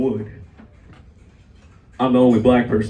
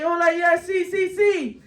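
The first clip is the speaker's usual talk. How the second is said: high-pitched mock Spanish agreement; eager playing along; 10 semitones higher than usual. Acts himself playing along as Hector.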